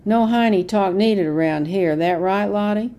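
loud, hostile sneer; bully her into agreeing; glowering jab. A narrator's reading. Deputy sneering at grandmother over German talk, loud and hostile. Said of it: "He glowered at grandmother".

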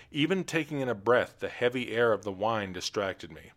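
plain reading voice

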